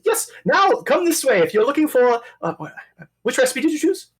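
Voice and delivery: high chef voice. Acted.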